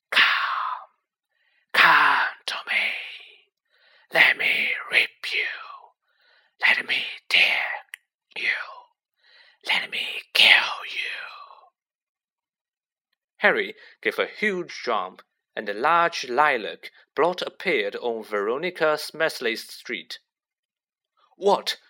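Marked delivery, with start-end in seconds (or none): 0.1-11.7 slow, menacing whisper; chilling venomous voice. A narrator's reading. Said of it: "a voice to chill the bone marrow". 21.4-21.8 loud, high startled question. A narrator's reading. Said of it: "he said loudly".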